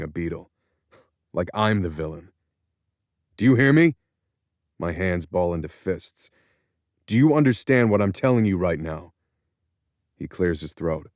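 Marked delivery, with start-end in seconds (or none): 3.4-4.0 loud, high, furious demand; angry shout. Acted. Son yelling at his father outside the prison, furious. 7.1-9.1 louder and higher, hard and insistent; angry. Acted. Son pressing his released father to listen, angry.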